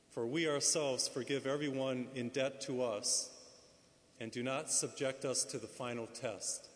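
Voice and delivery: soft, measured liturgical reading; quiet formal scripture reading; gospel reading. Acted. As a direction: proclaim the Gospel gently, at a steady pace.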